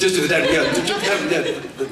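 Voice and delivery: breaking into laughter at the joke; laughing. Real, not acted. Joking about only the dead cast.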